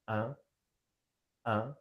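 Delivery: "un" with the mouth slightly open, nasal. Shown as a demonstration. Teacher demonstrating nasal "un" to class. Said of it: "you see the position of my mouth".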